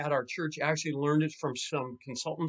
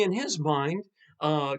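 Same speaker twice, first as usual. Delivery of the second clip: ordinary delivery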